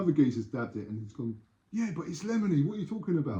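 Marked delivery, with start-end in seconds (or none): 1.7-3.4 higher-pitched sceptical buyer; acted objection. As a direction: play a doubting customer protesting. Acted argument over fake drugs.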